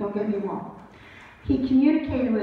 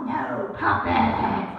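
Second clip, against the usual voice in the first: much lower and very slow, half laughing; stubborn spirit voice; much slower than usual. Pretend. Spirits refuse the psychic's plea, deep slow laughing voice. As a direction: drag it out deep like a stubborn ghost.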